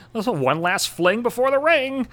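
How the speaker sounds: high mocking singsong; mock bachelorette slogan, pitched high. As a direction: sneer it in a high girly voice. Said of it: "dumb fucking slogans like".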